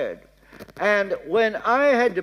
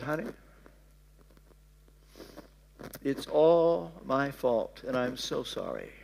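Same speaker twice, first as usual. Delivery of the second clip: tender apology; 6 semitones lower, 7 dB quieter than usual. Retold or imitated. Apologizing to tearful wife.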